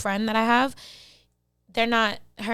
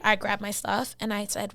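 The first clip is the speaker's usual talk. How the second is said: usual speaking voice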